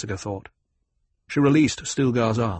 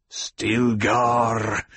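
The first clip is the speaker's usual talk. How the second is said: rumbled. A narrator's reading. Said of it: "Jameis rumbled".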